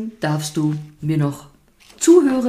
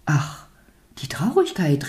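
ordinary delivery

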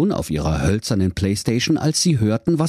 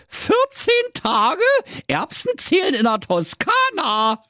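very high, piercing wake-up call; bossy mother. Acted. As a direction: roust the children in a put-on mother voice. Mother rousing children out of bed.

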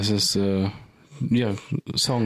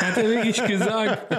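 high, laughing through the words; can't stop giggling while insisting; 11 semitones higher than usual. Real, not acted. Co-host laughing as he insists the quote is true.

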